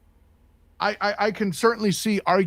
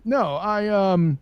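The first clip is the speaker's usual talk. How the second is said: usual speaking voice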